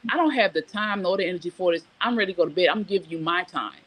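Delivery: usual speaking voice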